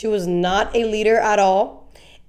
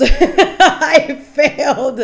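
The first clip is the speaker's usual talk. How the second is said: drawn-out, higher mock lament through laughter; 4 semitones higher, 5 dB louder, much slower than usual. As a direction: laugh while dragging out the mock confession. Mocking her letter while laughing.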